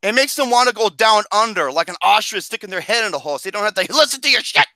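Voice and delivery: normal talking voice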